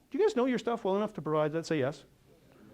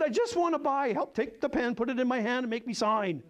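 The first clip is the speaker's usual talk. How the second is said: much higher, eager pleading; eager buyer; 7 semitones higher than usual. Retold or imitated. Retelling begging the presenter to make him sign, eager and high. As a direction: beg to be sold, half laughing. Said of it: "I said".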